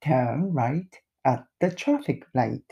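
very slow, each word laid out; a teacher's slow model read; slow model sentence. Shown as a demonstration. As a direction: say it slowly for learners. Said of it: "どのような文章でやるか".

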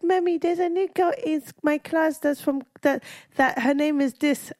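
high, halting kid impression; imitating young son. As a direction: mimic a child telling Mommy news. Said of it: "he was like".

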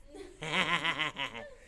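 imitated rapid cackle; put-on churchwoman's laugh; Sister Minga. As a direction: cackle in quick even bursts. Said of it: "'Cause she has that laugh".

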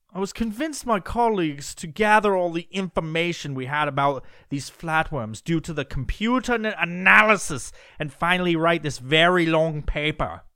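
put-on British scientist accent. Quoting the scientist's statement on flatworms, in a put-on British accent. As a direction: quote the researcher in a mock British accent. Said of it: "I'm gonna do this in a British accent".

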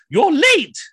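sharp high scolding yell; angry shout. Retold or imitated. Wife shouting at her late husband, angry shout. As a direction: snap at him for being late.

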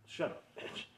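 unvoiced, under the breath; hushed mock comeback; whispered retort. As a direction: whisper the insult back. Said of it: "They're like, they gave me shit".

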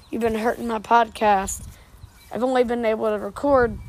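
usual speaking voice

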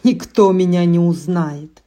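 loud triumphant witch cry; gloating shout. A narrator's reading. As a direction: crow it out loud. Witch gloating after transformation.

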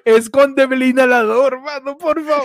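playful desperate wail; mock pleading. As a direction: beg as if in agony, hammed-up. Mock begging for harsh treatment.

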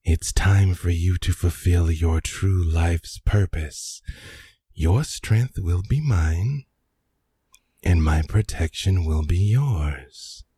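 low, slow, loud looming voice; plant voice in mind. Acted. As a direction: speak as an ancient hungry plant, slow and heavy. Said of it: "you hear in your mind".

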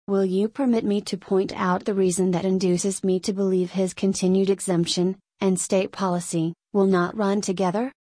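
ordinary delivery

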